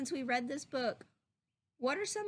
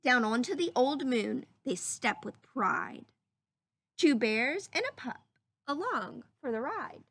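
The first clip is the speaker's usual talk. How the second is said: put-on lively storybook reading for children; read-aloud voice; 4 dB louder, slower than usual. Teacher reading a story to her class, lively read-aloud. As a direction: read it like a picture book to the class.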